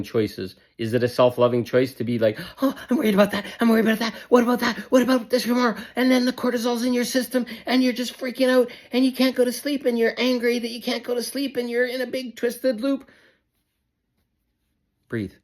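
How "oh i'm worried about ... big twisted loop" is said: high, squeaky panicked overthinker; anxious spiraling. Acted. Coach acts out a self spinning in worry, frantic and high-pitched.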